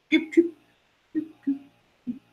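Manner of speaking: very high little electronic beeps; spaced, high-pitched alarm chirps; imitated beep. Storyteller mimicking the alarm in the night, imitated beeps.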